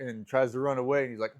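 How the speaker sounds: ordinary delivery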